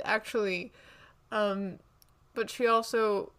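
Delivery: ordinary talk, nothing marked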